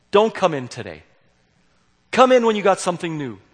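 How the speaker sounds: loud, firm command; retold tough-love telling off; sending a repeat visitor away, loudly; stern send-off.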